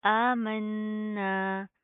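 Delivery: आमन्ना with the noon held long through the nose; nasal ghunna. Shown as a demonstration. Teacher models ghunna in a slow recitation. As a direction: hum the double noon of आमन्ना slowly. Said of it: "गुना करेंगे".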